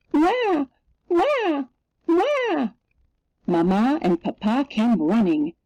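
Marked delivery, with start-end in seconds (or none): 0.1-2.7 very high storybook wails; harder mock crying. A narrator's reading. Narrator as the unrescued little girl, high mock wailing. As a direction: wail like a little girl, clearly acted. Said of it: "she cried even harder".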